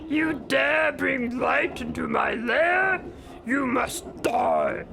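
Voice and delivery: slow, menacing villain voice; big, deliberate bad-guy proclamation; Ganon. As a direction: intone it as an evil overlord, drawn out. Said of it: "I can do the Ganon voice".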